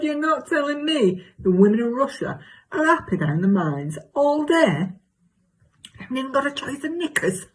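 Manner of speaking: high, mock-incredulous gossip; exaggerated comic self. Arguing with neighbour about women of Russia, high mock incredulity. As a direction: protest in a hammed-up comic character voice. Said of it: "do an exaggerated version of myself".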